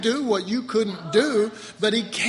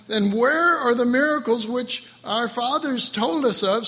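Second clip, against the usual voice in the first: loud, higher declaiming voice; forceful scripture reading; 5 semitones higher, 6 dB louder than usual. Acted. Preacher reads Gideon's response to God, declaiming.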